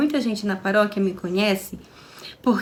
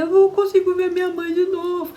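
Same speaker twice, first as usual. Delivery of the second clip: high, tearful retelling of her own words; a grieving wife to her husband, high and tearful; 8 semitones higher than usual. Retold or imitated. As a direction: relive the crying plea. Her retold crying reply.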